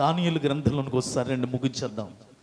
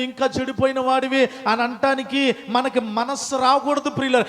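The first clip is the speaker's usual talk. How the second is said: impassioned pulpit exhortation; fiery preaching; 6 semitones higher than usual. Acted. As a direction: build the sermon up to full force. Preacher building to full force.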